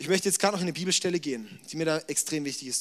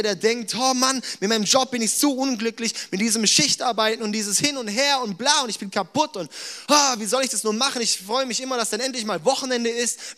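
raised voice, half acting a tired worker; fervent preaching; 5 semitones higher, 4 dB louder than usual. Preacher voices a worn-out worker to the church, fervent mock complaint. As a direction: preach it while playing the exhausted shift worker.

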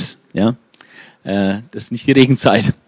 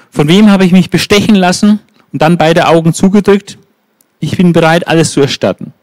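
indignant preaching; 5 semitones higher than usual. Acted. Prophet daring the people to name his wrongs, preaching hard. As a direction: challenge the crowd to accuse you.

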